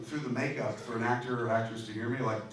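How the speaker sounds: ordinary talk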